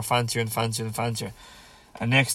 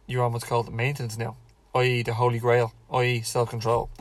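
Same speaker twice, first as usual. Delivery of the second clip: ordinary talk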